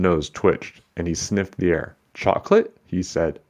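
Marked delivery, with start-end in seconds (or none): 2.1-2.8 high, rising sniffing question; curious surprise. Acted. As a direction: perk up at the smell.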